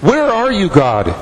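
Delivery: high, pleading cry to God; desperate high plea to God; anguished plea. Acted. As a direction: cry it up to heaven, then drop.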